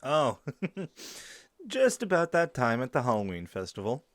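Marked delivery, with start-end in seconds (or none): 0.4-1.4 soft, high little laugh; amused chuckle. Acted. Host caught talking about friend, soft chuckle. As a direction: let out a short light giggle.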